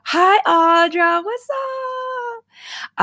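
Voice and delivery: light up when she spots her; squealy greeting. Real, not acted. Streamer squealing hello to a viewer in chat.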